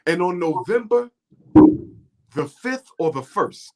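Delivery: ordinary delivery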